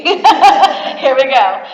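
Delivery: loud, slow laugh through it. Real, not acted. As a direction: laugh as the push-ups start. Laughing start of push-ups.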